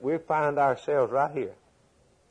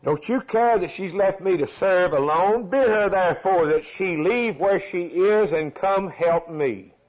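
complaining; 6 semitones higher, 3 dB louder than usual. Retold or imitated. Martha's complaint acted out.

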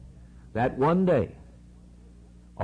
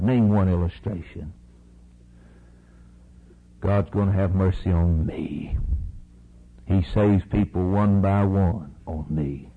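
usual speaking voice

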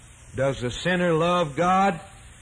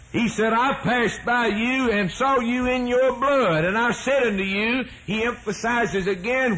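higher, building preacher's chant; preaching; 8 semitones higher than usual. Acted. Preacher quoting scripture to the congregation, building fervor. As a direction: lift the congregation, pressing each phrase. Preacher rising into full cry.

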